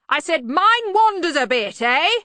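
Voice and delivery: hearty shout, pitched high. Acted. Arch-Chancellor repeating it for the dazed bursar, shouting. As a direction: bellow it as if to someone deaf.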